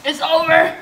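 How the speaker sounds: loud. Real, not acted. Giving up on the challenge.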